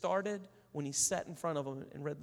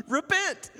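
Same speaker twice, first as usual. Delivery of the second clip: sheepish realization; 12 semitones higher, 9 dB louder than usual. Retold or imitated. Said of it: "I was like".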